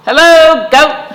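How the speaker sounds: high, loud cheery duck hello; Duck's greeting. A narrator's reading. Storyteller voicing Duck greeting Cow, high and loud. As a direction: call out brightly as Duck.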